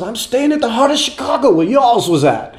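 lightly hammed Buddy on the phone; imitating [name]. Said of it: "He says".

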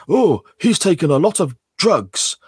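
hammy school play delivery. Retold or imitated. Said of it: "a drugs are bad play at school".

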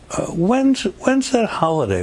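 high-pitched, hesitant put-on question; imitated woman asking. Woman stopping him in Macy's to ask, imitated high voice. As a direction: ask it high, stumbling over the start. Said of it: "a woman stops me and says".

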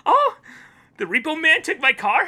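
high, loud forced cheer; over-the-top optimist. Acted. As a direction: take bad news with hammed-up brightness. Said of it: "over-the-top optimist".

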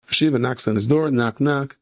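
usual speaking voice

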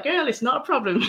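put-on a light, laughing take on mum, no accent; mum's words, no accent. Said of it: "I won't do the accent, but she was like".

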